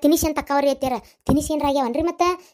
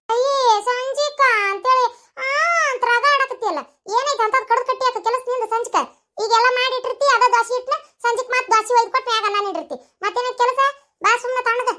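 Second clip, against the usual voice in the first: put-on higher, scolding old mother-in-law; higher-pitched scolding mother-in-law voice; bossy mother-in-law; 7 semitones higher than usual. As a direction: nag her like a village mother-in-law.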